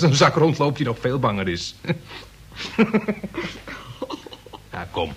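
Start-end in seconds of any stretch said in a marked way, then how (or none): 2.7-5.1 breathy relieved laugh. Acted.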